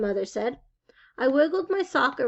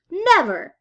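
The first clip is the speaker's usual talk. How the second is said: high, loud final no; flat refusal; 6 semitones higher, 9 dB louder than usual. Acted. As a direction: slam the door on it. Boy refuses saddle shoes.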